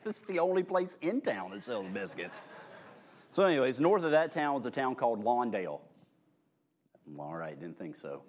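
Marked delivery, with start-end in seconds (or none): 0.0-2.4 sarcastic. Retold or imitated. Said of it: "I'm like".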